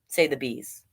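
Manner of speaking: usual speaking voice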